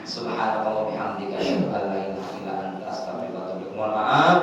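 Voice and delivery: low, soft closing supplication; closing prayer. Real, not acted. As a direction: recite the closing prayer quietly. (doa penutup yang pelan)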